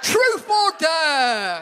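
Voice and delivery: teasing back at Ken, slow, loud and high; drawn-out mock question. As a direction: stretch it out like a drunk game host. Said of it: "I was like".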